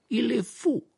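high-pitched, doubtful verdict; dubious. A narrator's reading. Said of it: "dit Krum d'un air dubitatif".

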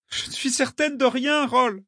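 put-on high-pitched girl's call, little extra volume; calling back. A narrator's reading. Girl answering from the bank, calling back. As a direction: pitch it up to carry through rain.

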